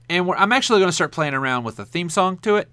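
normal talking voice

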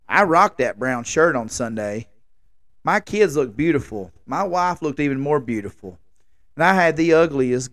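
normal talking voice

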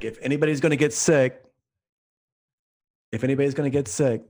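imitating a skipper's loud warning; skipper's announcement. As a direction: call it out pointedly as the skipper. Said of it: "the skipper's like".

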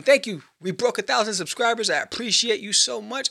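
excited thanks. Real, not acted. Celebrating subscribers.

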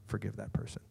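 hushed whisper. Pretend. Pastor voicing Jesus to the congregation, tender whisper. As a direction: drop to a soft whispered promise. Jesus quoted offering help.

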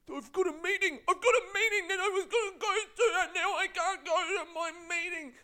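loud, very high mock bawling; hammed-up crybaby wail, one high pitch; whining tantrum. Mocking delayed passenger who'll miss his meeting, wailing tantrum.

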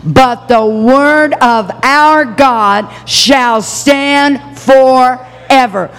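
very slow, drawn-out proclamation; leading the church in a slow proclamation; proclaiming scripture. Shown as a demonstration. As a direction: proclaim every word with the congregation. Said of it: "read that last phrase with me".

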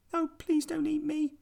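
very high squeaky mouse; tiny mouse voice. A narrator's reading. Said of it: "said the mouse".

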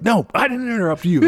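fed-up retort, pitched high; Chomsky. Retold or imitated. Debater refusing to be talked over, mock fed-up retort. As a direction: imitate someone who has had enough. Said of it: "had had a fuck enough and was just like".

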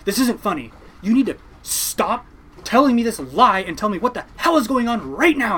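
mock a furious mother calling out a prank; angry mom. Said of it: "She goes".

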